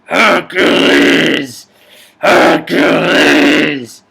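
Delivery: very loud, very slow bellowed name; drawn-out booming villain shout; villain roar. Acted. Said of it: "I'm gonna do the bad guy".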